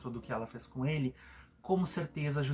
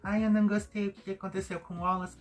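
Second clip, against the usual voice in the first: mocking naive woman; 5 semitones higher than usual. Mocking a housemate who defends a man, naive high voice. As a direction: mimic her gushing defence in a higher voice. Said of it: "ela tava ali falando".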